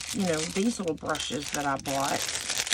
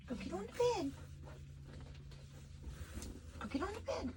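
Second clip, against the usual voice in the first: much higher, soft coaxing pet voice; coaxing the dog; 8 semitones higher, 6 dB quieter, faster than usual. Real, not acted. Urging her sick dog onto the bed in high, soft pet talk.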